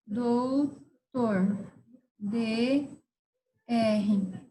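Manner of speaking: DOUTOR split into two long syllables; slow dictation. Shown as a demonstration. Teacher dictating a word to abbreviate, doutor in slow syllables. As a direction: draw out doutor with a gap between dou and tor.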